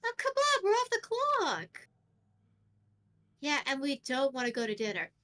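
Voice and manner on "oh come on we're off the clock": high, whiny pleading voice; pleading appeal. Acted. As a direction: coax them in a high voice, drawing out the end. Pleading with friends who refuse.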